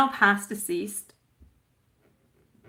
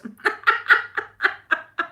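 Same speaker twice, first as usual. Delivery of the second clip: breathy laugh; 13 semitones higher, 4 dB louder than usual. Real, not acted. Laughing at her own joke.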